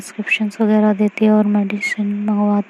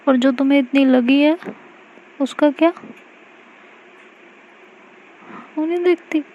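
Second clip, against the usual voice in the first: higher, rising hurt question; pointed retort; 5 semitones higher, faster than usual. Acted. Mayra to Aashi, hurt pointed retort. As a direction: turn it back on her.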